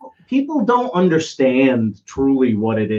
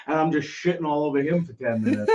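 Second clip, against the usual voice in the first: normal talking voice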